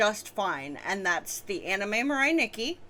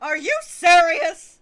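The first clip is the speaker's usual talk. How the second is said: indignant yell; 9 semitones higher, 12 dB louder, slower than usual. Acted. Character outraged at protagonist.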